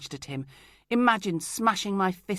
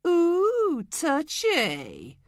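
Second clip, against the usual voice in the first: high, loud teasing ooh; mock her with a sing-song; 11 semitones higher, 7 dB louder, much slower than usual. Craig taunting her temper, a high loud mocking ooh.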